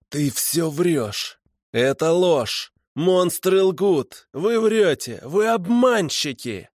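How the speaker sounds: high, drawn-out furious cries; growled denial. A narrator's reading. Cornered killer calls his captors liars, furious. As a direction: build from a slow growl to high outcry. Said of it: "Потом зарычал".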